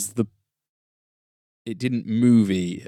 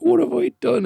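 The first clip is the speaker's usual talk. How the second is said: high, overdone despair; melodramatic wail. Retold or imitated. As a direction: ham up the tragic cry. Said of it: "then is like".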